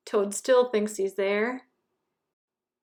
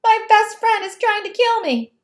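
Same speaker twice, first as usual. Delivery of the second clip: high, loud hammed-up alarm; mock panic; 11 semitones higher, 8 dB louder, faster than usual. Toad complaining that Frog smothers him, high mock panic. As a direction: overplay the terror for fun.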